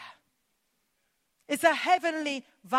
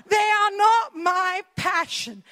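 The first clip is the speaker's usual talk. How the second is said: louder and higher, slow, each word hammered; emphatic outcry; 5 semitones higher, 6 dB louder than usual. Real, not acted. Preacher confessing her horror of flags, slow emphatic cry. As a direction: declare it like a protest, dragging it out.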